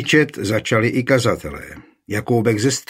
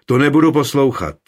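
loud, higher angry refusal; angry outburst; 5 semitones higher, 7 dB louder, faster than usual. A narrator's reading. Hot-tempered preacher jumps up refusing to listen, loud and angry. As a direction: flare up and snap back. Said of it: "rozlobil se Jakoubek ze Stříbra".

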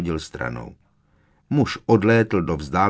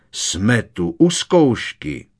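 slow, louder voice of an affronted professor; indignant; 3 semitones higher, 4 dB louder, much slower than usual. A narrator's reading. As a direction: draw it out, scandalised and raised. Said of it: "ozval se pohoršeně profesor Tofty". (pohoršeně, protahovaně)

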